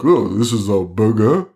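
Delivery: put-on French accent, mock bewilderment. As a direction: say it like a confused Frenchman. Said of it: "you'd say it in a French accent".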